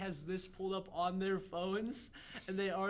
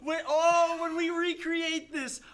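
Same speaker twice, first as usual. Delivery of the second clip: loud, raised, laughing enthusiasm; real excitement; 5 semitones higher, 6 dB louder, much slower than usual. Real, not acted. Friend delighted by live-action casting, excited. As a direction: burst out thrilled at the idea.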